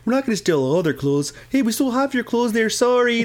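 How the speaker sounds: put-on high, apologetic Canadian accent; mock Canadian thief. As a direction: play a polite Canadian burglar. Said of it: "That's very Canadian thief".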